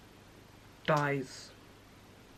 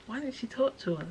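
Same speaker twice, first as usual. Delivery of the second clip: bewildered question; 7 semitones higher, 7 dB quieter than usual. Retold or imitated. Voicing bewildered grieving friends.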